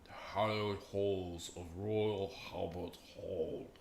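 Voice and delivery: slow, stretched British vowels; mock British accent. Shown as a demonstration. Streamer showing off a supposedly perfect accent, mock British accent. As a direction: intone it grandly in a fake British accent. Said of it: "uh, perfect British accent".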